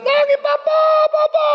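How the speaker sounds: put-on high, wailing cry of a grieving child. A child weeping over his dead father, high wailing cry. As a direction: wail for Papa like a little child.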